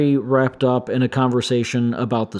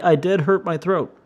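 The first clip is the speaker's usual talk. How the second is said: ordinary talk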